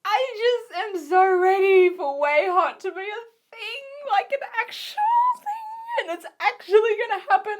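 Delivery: high, whiny excited plea; near-tearful high-pitched gushing; fangirl yearning. Real, not acted. Fangirl longing for couple to happen.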